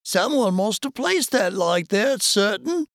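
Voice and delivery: high, agitated character voice; Isaac's alarmed reply. A narrator's reading. Isaac replying about the light in the tower, agitated. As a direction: pitch it well up, as a worried character. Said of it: "was Isaac's reply".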